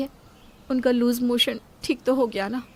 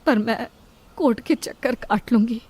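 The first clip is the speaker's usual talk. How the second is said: ordinary talk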